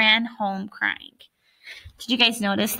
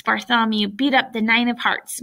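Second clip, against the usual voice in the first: plain reading voice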